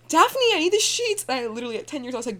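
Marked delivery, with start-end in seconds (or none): 0.1-1.2 whiny cousin. Retold or imitated. Cousin wakes her at night.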